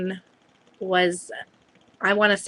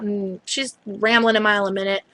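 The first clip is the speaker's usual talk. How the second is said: ordinary delivery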